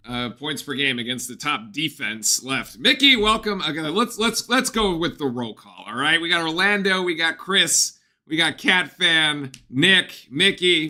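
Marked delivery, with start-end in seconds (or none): 2.8-10.9 loud, upbeat welcome to viewers; energetic stream intro. Real, not acted. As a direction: hype up the roll call. Streamer greeting viewers in roll call.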